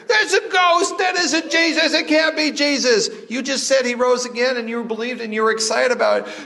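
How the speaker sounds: hammed-up cartoon fright; mock panic. Disciples panicking at a ghost, very high laughing mock terror.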